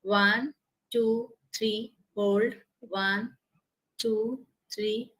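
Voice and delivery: quiet slow count for breathing; soft, calm breathing count; counting breaths softly. Shown as a demonstration. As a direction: pace the breath count gently. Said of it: "So total we'll do nine".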